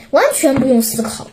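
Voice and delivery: ordinary delivery, nothing marked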